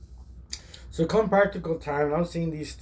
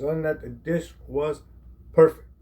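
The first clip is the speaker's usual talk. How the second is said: ordinary delivery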